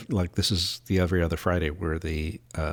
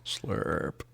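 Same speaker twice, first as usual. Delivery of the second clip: whispered slurp; 7 dB quieter than usual. Pretend. Whispered sound effect.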